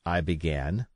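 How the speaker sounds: plain reading voice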